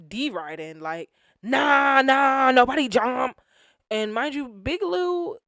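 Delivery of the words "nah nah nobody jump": higher, louder mimicked heckling. Big Lou in the back backing Chef D, loudly. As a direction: imitate a noisy hanger-on yapping from the back. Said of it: "is very loud".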